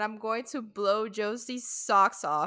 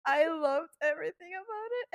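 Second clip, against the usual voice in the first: ordinary talk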